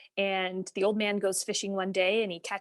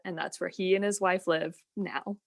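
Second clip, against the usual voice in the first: ordinary talk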